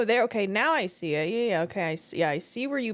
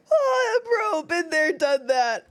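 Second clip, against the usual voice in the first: laughing; 7 semitones higher, 3 dB louder, slower than usual. Real, not acted. Still laughing after the clip.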